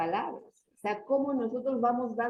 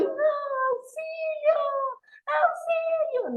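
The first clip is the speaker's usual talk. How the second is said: desperate cry for help; 3 dB louder than usual. Acted. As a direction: act out desperate pleading, high-pitched. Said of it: "desesperación".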